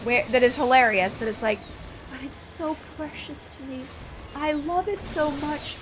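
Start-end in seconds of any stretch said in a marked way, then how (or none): 2.1-5.8 put-on high, soft, slow pleading little girl voice; precious child plea. Mother imitates her daughter begging to keep the owl, high soft pleading. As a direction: plead like a sweet dramatic four-year-old. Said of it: "she does this thing".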